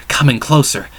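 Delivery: plain reading voice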